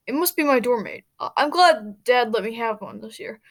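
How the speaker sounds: ordinary delivery, nothing marked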